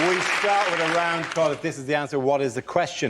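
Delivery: show host read. Acted.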